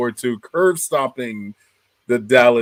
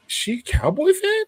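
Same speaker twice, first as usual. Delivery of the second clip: very high incredulous question; shocked dad. Retold or imitated. Dad asking about the teacher, in high disbelief. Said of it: "he's like".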